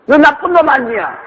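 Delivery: put-on high, indignant child's call; shouted accusation. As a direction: fling it across the valley.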